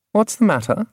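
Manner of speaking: high, lightly voiced question; Angela's voice. A narrator's reading. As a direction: raise the pitch for Angela. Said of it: "Angela inquired".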